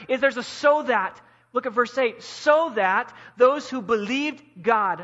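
loud, driving sermon voice, hammering each point; forceful preaching. Acted. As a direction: preach it at full force to the congregation.

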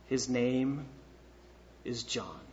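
low, hushed statement with a pause mid-phrase; quiet, understated. Retold or imitated. Preacher reads out the written phrase to the congregation, quiet and low. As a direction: say it softly and low, in two pieces.

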